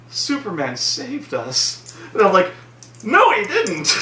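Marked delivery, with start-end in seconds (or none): 0.1-2.0 softer, slow hushed awe; mocking the survivor's awe, soft and slow; mock grateful survivor. Voicing a survivor lady. 3.0-3.9 retort. Retold or imitated. His retort to the movie.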